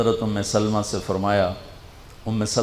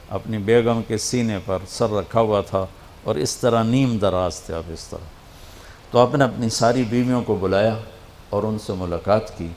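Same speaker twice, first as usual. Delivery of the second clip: ordinary delivery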